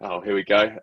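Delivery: ordinary talk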